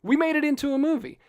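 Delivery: hammed-up announcer pitch for the book; mock poster pitch. Poster hyping the book to moviegoers, mock announcer tone. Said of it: "the original Disney Bambi poster is like".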